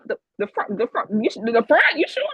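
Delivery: can't believe it; stunned disbelief. Retold or imitated. Fan hearing she sits in the front row, stunned.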